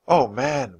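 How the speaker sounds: usual speaking voice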